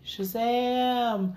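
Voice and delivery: a high hammed-up yell of the magic word; mock Shazam shout. Mocking the movie, a high hammed-up Shazam cry. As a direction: call it out like the kid hero in a rush.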